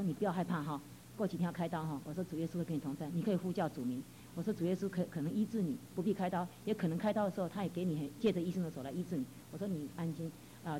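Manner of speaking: quietly calming a patient before his operation; soft reassurance. Retold or imitated. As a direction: comfort a frightened man before surgery. Comforting the sick man.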